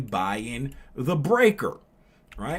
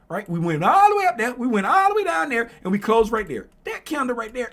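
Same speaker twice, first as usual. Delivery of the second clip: excited teaching; 7 semitones higher, 5 dB louder than usual. Real, not acted. Teacher working up over candle.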